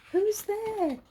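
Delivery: high, curious owl voice; little owl asking. A narrator's reading. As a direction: call out, wondering who's there. Olive asks who answered.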